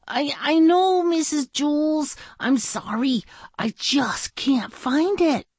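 put-on high, pleading child voice; apologetic pupil. Dana apologising to Missus Jules, high and pleading. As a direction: stammer the start, apologise up high.

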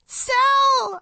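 high desperate scream; girl screaming at her hurt friend. Acted. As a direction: scream his name. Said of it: "I screamed".

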